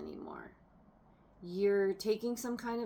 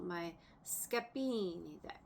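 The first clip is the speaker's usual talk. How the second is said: a mock Italian accent on the deck name; terrible Italian accent; 6 dB quieter than usual. Shown as a demonstration. Tarot reader naming her deck in a mock Italian accent. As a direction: say Scapini like a hammed-up Italian. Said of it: "in my terrible Italian accent".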